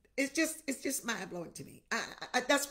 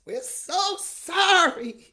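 louder, drawn-out cry of sorry; loud slow plea; 6 semitones higher, 12 dB louder, much slower than usual. Real, not acted. Pastor crying out to God in repentance, loud and slow. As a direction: belt out sorry slowly, like a wail.

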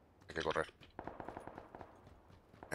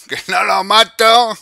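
higher, louder, slow frustrated wail; drawn-out whine; 9 semitones higher, 9 dB louder, much slower than usual. Real, not acted. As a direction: stretch it out in disbelief.

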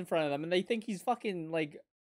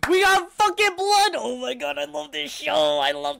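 high, loud thrilled yell at the screen; cheering the bloody fight; excited shouting; 6 semitones higher, 6 dB louder than usual. Real, not acted. Anime reactor yelling at the screen, thrilled.

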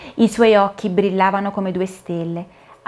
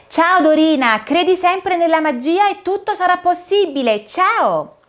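cheerful Cinderella farewell; 10 semitones higher than usual. Acted. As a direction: call out a happy farewell. Cinderella waves goodbye.

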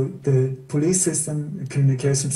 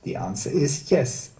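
ordinary talk

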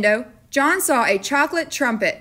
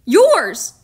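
louder, higher single word; blunt boy; 5 semitones higher, 8 dB louder than usual. A narrator's reading. Boy blaming the storekeeper, louder and higher. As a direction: spit it back at him. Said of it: "John said".

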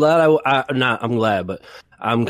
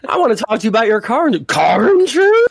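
much higher, fast, squeaky parody; high mocking voice; 10 semitones higher, 3 dB louder than usual. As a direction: squeak it out like a silly caller. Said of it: "you answering that spam call".

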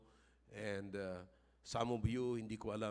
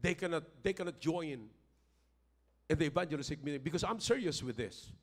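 high, loud preaching that swells to a shout; preaching at full force; 7 semitones higher, 6 dB louder than usual. Acted. Pastor to the congregation about money, preaching at full force. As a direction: drive it home like a fired-up pastor.

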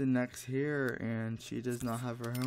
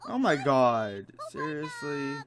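exasperated outburst; 3 semitones higher, 7 dB louder than usual. Real, not acted. Exasperated at Sim's homework.

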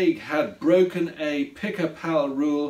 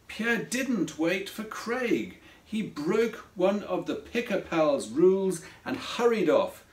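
ordinary delivery, nothing marked

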